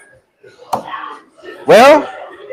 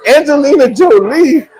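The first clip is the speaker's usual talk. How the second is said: higher, stunned question; disbelief; 7 semitones higher than usual. Retold or imitated. Husband stunned by his wife's answer. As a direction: ask it in disbelief. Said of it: "I said".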